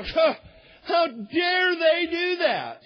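gasp, then high, slow indignation; mock outrage. Preacher voices everyone reacting to backroom deals, in mock outrage. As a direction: act out scandalized indignation, drawn out and high. Congregation's outrage at lawmakers, acted out.

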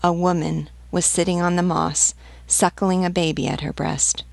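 plain reading voice

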